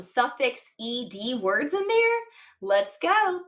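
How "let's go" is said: ordinary talk, nothing marked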